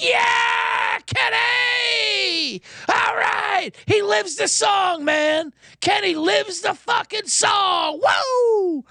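high ecstatic shouted cheers; crowd cheering wildly. Retold or imitated. Crowd cheering Kenny walking away, high ecstatic shouting. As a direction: whoop like a fan who's seen a legend. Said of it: "everyone's like".

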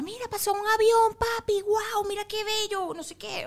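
put-on high-pitched wonder for a little child; distracting a toddler. Mother distracting crying son with a plane, high-pitched wonder. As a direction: point things out in a lifted, excited voice. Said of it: "distraerlo con otra cosa".

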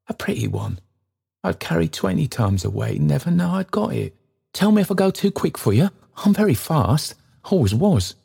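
put-on kindly old man pressing his offer. Trotty offering the stranger lodging, eagerly.

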